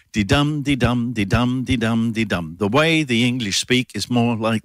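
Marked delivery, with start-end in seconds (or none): 0.1-2.5 chanted di-dum, stress on dum; di-DUM di-DUM, weight on the second beat; iambic beat. Shown as a demonstration. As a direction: tap out the iambic rhythm. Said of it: "the way the English speak is called iambic".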